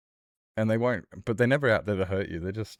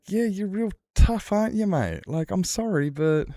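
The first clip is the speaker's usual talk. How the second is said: much higher, louder taunting sarcasm; mocking a pub brawler who picks fights, high and sarcastic; 11 semitones higher, 4 dB louder than usual. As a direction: sneer at his toughness with mock praise. Sarcastic retort to bar fighter.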